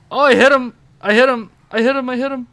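high, loud repeated protest; excited outcry. Real, not acted. Player insisting the vehicle hit its target, excited outcry.

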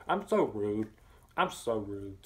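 words round a bite of food; chewing between words; mouth full. Real, not acted. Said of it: "Talking with my mouth full".

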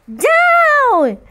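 witch's high, loud cry to land. Acted. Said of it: "cried the witch".

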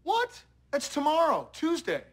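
high-pitched surprised protest; startled disbelief. Acted. Parent learning the game was missed, startled. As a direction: react in shock, voice jumping up.

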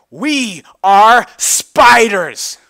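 very loud, slow, high proclamation; loud slow declaration. Acted. Big Mac declares to Twilight, loud and slow. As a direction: boom it out like a grand announcement.

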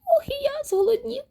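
very high, hungry mouse whine; high-pitched little-creature complaint; squeaking mouse. A narrator's reading. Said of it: "пищить Круть".

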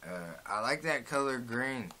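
talking around snuff pinch. Real, not acted. Seen 2: Spits into cup before and after; lower lip looks slightly full throughout.

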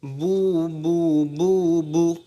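long "বু" with rounded lips; slow, held "বু" drill; rounded pesh sound. Shown as a demonstration. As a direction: round the lips and send each "বু" forward. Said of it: "গোল করবেন এবং আওয়াজকে সামনের".

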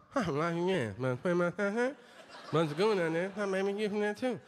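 high, garbled mock mumble; mumbling stranger. Fan after a show rambling unclearly, mock garbled. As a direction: imitate a guy who can't be understood.

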